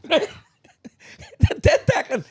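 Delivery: high, laughing telling. Real, not acted. Telling the roundabout story while laughing hard. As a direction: push the story out through laughter.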